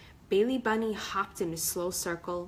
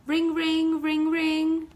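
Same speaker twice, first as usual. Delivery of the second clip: loud, high sing-song ringing; phone ring; 9 semitones higher, 8 dB louder, much slower than usual. Acted. Narrator making the cell phone ring, loud and high.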